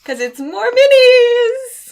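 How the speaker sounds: high, loud, drawn-out squeal of glee; excited reveal. Real, not acted. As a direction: stretch it out into a loud, high cheer. Gleeful reveal of craft finds.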